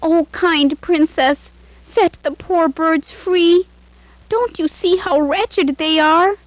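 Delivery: high, slow, begging maid's voice; pleading. A narrator's reading. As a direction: beg the princess for mercy. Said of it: "Nilanjana went to the princess and pleaded".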